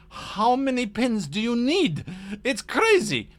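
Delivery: high, loud, exasperated impersonation; Demetrius. Retold or imitated. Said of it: "That was my Demetrius impersonation".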